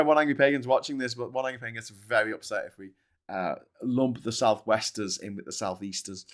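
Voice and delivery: ordinary delivery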